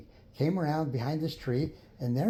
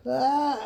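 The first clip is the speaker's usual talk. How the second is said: high open-mouthed ah; a sudden high ah as the mouth opens; mock gulp; 11 semitones higher, 3 dB louder than usual. Character opening mouth wide.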